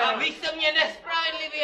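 loud complaint. Pretend. Complaining loudly.

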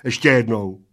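loud, firm ruling at normal pace; decide on "Ještě jednou" without dragging it; decisive. A narrator's reading. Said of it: "rozhodl Plešatec".

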